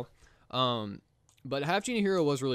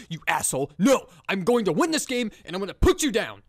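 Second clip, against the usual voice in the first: louder, higher mock fury at the announcer; mock defiant retort; 6 semitones higher, 7 dB louder than usual. Hammed-up comeback shouted at the smug Monopoly announcer. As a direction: snap back like you're taking him down. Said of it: "I'll just be like".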